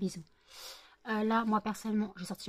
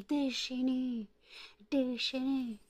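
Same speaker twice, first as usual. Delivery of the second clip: high, slow drawn-out "Déchaîné"; pet voice; 4 semitones higher, much slower than usual. Real, not acted. Owner teasing her wild dog, high slow pet-talk.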